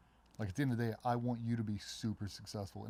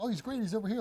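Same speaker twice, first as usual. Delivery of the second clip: much higher, louder happy news; excited news; 12 semitones higher, 4 dB louder, faster than usual. Retold or imitated. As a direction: share it gladly. Telling where the mutual friend is.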